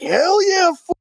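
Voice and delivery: hammed-up Chicano homies celebrating. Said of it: "They're like".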